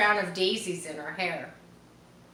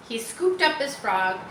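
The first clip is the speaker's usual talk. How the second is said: plain reading voice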